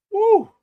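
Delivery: loud, high-pitched woo; whoop. Real, not acted. Host relieved about the cup, high whoop. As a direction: let out one high whoop.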